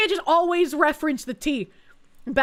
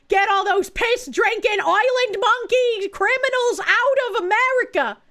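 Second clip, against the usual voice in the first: mock patriotic rant; 7 semitones higher, 3 dB louder than usual. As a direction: proclaim it like a fake demagogue. Streamer mocking nationalist rant.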